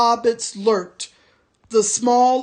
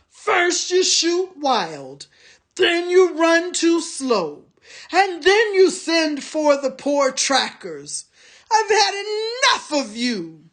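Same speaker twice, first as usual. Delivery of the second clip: higher, disgusted scolding; scornful orc tracker; 7 semitones higher than usual. A narrator's reading. Tracker berating the soldier, scornful. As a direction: tell him off and storm away.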